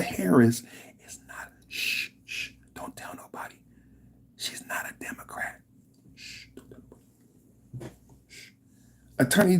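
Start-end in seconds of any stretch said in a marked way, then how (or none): 1.1-8.5 hushed conspiratorial whisper; teasing whisper, shh-ing the listener; mock secret whisper. Mock secret about Kamala.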